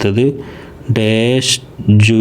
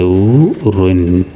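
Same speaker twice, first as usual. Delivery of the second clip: ഉ റുൻ said very slowly, sound by sound; slow model reading; much slower than usual. Shown as a demonstration. Teacher reads the gapped word slowly for pupils. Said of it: "dash ഉ റുൻ".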